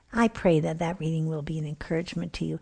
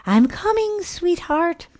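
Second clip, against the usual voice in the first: much higher sing-song reply; sweet mom clone; 12 semitones higher, 4 dB louder, slower than usual. Acted. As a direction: coo it back to the child. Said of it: "The clone replied".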